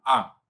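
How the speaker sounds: Spanish A, a pure open ah. Shown as a demonstration. Teacher modelling the Spanish A vowel. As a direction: say a clean Spanish A. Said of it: "vemos la A española".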